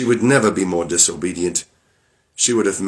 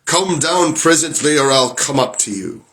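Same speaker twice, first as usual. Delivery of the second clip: bellowing Bluebeard; 6 semitones higher than usual. Acted. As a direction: roar up the stairs at her. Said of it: "cried out as loud as he could".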